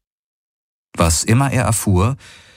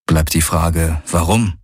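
ordinary delivery

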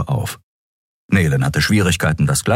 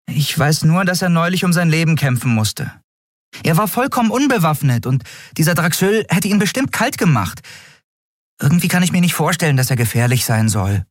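high, light character voice; earnest little Nelen; 13 semitones higher than usual. Acted. Small crewmate uncertainly defending Kai, high and earnest. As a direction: speak up for him, pitched very high.

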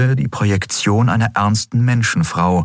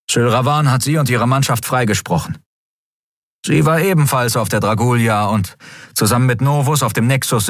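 higher-pitched voice of a servant reporting; lightly voiced dutiful adjutant; 5 semitones higher than usual. A narrator's reading. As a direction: report to your master, pitched a little up.

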